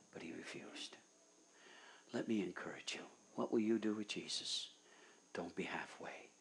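soft, near-whispered plea; hushed appeal. Acted. Preacher closing the sermon with an intimate plea, soft hushed voice. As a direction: draw them in quietly.

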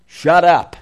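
loud, abrupt hushing order; mock sharp command. The multitude telling two beggars off, mock loud command. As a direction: imitate a crowd silencing someone. Acted crowd silencing the beggars.

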